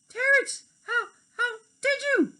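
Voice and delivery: very high, loud astonishment; surprised fairy. Acted. Tink startled by Terrence, very high surprised voice. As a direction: blurt it out in shock.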